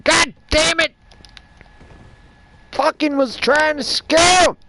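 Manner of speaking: hammed-up Southern accent outburst, loud and high; southern yell. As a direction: shout it like a startled Southern guard cursing. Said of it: "Why am I doing a southern voice?".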